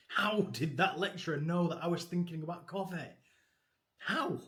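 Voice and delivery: wide awake, asking how he knew; amazed disbelief. Retold or imitated. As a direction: play the baffled student, lightly hammed-up. Student baffled by mind-reading lecturer.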